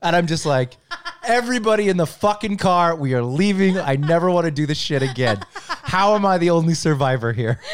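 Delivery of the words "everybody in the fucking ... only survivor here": high, loud, laughing exasperation; exasperated yell. Retold or imitated. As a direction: order everyone to the car, half laughing. Fed up dad ordering kids out.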